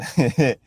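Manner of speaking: giggling at what's coming; anticipatory chuckle. Real, not acted. Teacher amused by what happens next, chuckling. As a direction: break into a short run of chuckles. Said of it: "I'm laughing because of what's about to happen next".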